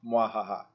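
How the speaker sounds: low mock villain cackle; evil laugh. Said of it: "That was my version of the evil laugh".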